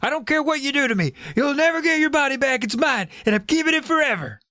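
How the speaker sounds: villain refusing to give the body back, defiant; defiant cry. Acted. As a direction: spit it out stubbornly. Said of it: "he cried".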